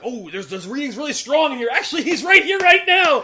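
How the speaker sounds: rising to a loud, high cry; mock alarm. Trackers realise their quarry is in the room, mock alarm. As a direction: build to a comic realisation. Retold trackers find him right there.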